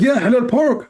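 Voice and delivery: high, innocent reply; naive friend. Retold or imitated. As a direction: answer high and clueless. Friend naively confirms order.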